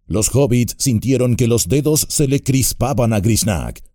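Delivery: ordinary delivery, nothing marked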